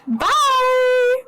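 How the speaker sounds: high, loud chirpy bye; bright farewell. Acted. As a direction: call out a cheery goodbye. Cheerful parting call.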